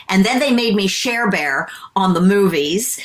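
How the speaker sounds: ordinary delivery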